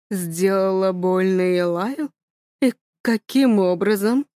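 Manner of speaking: slow, low, wary father; slow question. Acted. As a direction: ask it slowly, suspicious. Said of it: "медленно спрашивает отец". (медленно и низко, настороженно)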